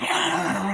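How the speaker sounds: high, loud imitated monster screech; creature shriek. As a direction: mimic the thing's squeal. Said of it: "the dog is getting all".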